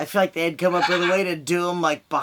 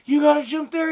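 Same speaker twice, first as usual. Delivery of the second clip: excited urging; 8 semitones higher, 4 dB louder than usual. Retold or imitated. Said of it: "I kept going, like".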